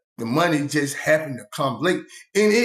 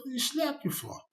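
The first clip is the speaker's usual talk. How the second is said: pitched way up in disbelief; incredulous higher question to the slapped cellmate; surprised question; 11 semitones higher, 10 dB quieter than usual. Retold or imitated. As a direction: ask it high, startled. Asking the slapped inmate.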